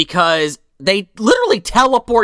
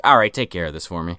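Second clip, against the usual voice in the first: much lower, fast and dismissive; low offhand Santa; 12 semitones lower, 4 dB quieter, faster than usual. Retold or imitated. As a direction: shrug the kid off quickly. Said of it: "He's like".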